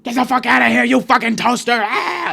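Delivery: high, loud outburst at a machine; screaming. Acted. Patient screams at an AI machine, furious high scream. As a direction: scream abuse, ending in a shriek. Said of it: "I just kinda scream at it".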